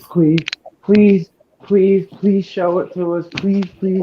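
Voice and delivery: begging. Real, not acted. Begging to be shown the fish.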